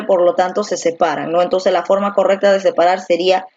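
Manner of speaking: ordinary talk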